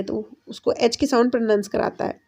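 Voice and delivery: usual speaking voice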